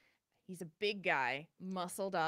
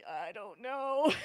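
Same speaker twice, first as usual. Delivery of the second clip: slow, laughing uncertain answer; nervous laugh; 3 semitones higher, slower than usual. Real, not acted. Passenger answering the flight attendant with a slow nervous laugh.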